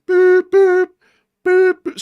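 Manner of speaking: high, loud dial-tone boops; loud boops on one high note; imitated phone beeps. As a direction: mimic a phone line beeping. Imitating phone call tones.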